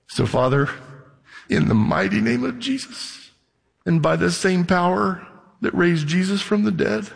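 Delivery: earnest pastoral prayer voice. Acted. Pastor praying protection over his congregation, earnest and hushed.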